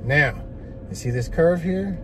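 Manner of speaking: ordinary talk, nothing marked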